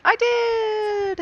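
high, drawn-out triumphant squeal. Real, not acted. Gamer finding she reached a thousand, triumphant squeal. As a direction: crow it in delight, stretching the last word.

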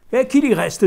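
high, loud sneering retort; bitter scoff. A narrator's reading. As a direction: spit it out with a bitter laugh. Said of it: "avec un rire amer".

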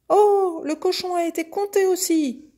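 very high, loud calf exclamation; calf crying out. A narrator's reading. Calf seeing the pig counted too, high loud cry. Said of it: "s'écria le veau".

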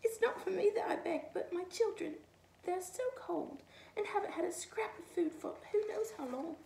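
high, pleading little rabbit voice. A narrator's reading. Rabbit begs food for her children, high and pleading. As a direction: beg for the children, small and high. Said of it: "wept the rabbit".

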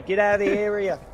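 ordinary talk

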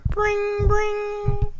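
high held bring bring; bell ring imitation. Shown as a demonstration. High ringing imitated for the pets. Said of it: "clock go".

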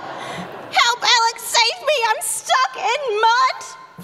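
high, laughing cries for help; stuck in mud. Pretend. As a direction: plead to be rescued, half laughing. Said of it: "pretend, [name], that you're stuck in the mud".

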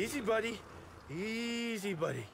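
soft, slowing calm to an animal; soothing. Acted. Soothing a nervous animal, soft and slow.

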